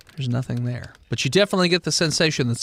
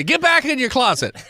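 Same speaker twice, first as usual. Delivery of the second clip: yelling; 8 semitones higher than usual. Acted. Yelling at a player.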